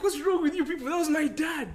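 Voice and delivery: Tarzan in a high, loud mock outrage; hammed-up high cry of outrage; mock outraged yell. Tarzan yelling at the zoo crowd, mock outraged high cry. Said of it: "And then dude's like".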